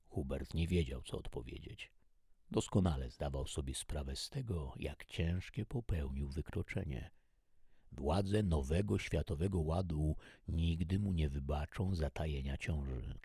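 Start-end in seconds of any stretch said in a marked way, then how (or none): none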